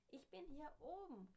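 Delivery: high call from far away. Acted. Herr Matzen calling down to the townsfolk, high call. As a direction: call down from the mountaintop. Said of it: "rief der kleine Herr Matzen".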